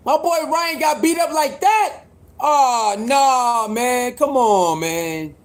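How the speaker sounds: high, pained cry of disbelief; anguished cry. Real, not acted. Hearing Ryan lost, crying out in disbelief at his viewers. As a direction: wail it out like your fighter just got beaten.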